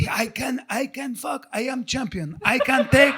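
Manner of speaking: imitating a Middle Eastern accent, bragging, laughing; friend's accent. Mocking his friend Tawfiq's bragging in a Middle Eastern accent. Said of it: "And he would".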